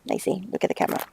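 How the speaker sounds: breathy hushed plea to the cat; whisper. Real, not acted. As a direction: coax her gently under your breath.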